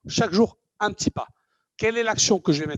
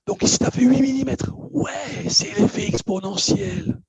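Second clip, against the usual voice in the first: mock amazement; 7 semitones higher, 4 dB louder than usual. Speaker acting out the revelation of the exponential effect, mock-amazed. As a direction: exclaim it like a stunned pupil who finally gets it.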